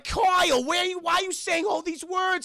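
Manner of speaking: very high, loud upset mother; Missus Boflosky. Retold or imitated. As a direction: scold your son in a put-on mom voice. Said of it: "she is very upset".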